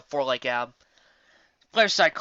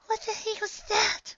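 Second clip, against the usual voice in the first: high, slow and timid; Fluttershy voice; 3 dB quieter, slower than usual. Acted. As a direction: ask it meekly and scared. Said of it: "Fluttershy asked".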